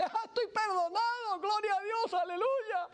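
high, elated cry of relief; jumping for joy. Acted. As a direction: burst out with giddy joy. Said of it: "sale saltando de alegría".

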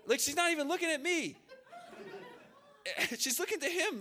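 real amused laughter through the words; laughing. Real, not acted. Amazed laughing retelling.

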